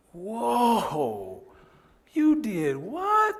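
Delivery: stunned, rising to a slow high cry; shocked reaction. Retold or imitated. Instructor reading a report, shocked slow exclamation.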